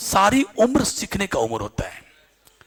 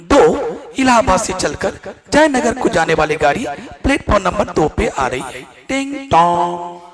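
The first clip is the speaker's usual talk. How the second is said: over the intercom. Shown as a demonstration. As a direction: boom it out like a platform speaker. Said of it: "इसको आवाज हम बढ़ाते हैं ना".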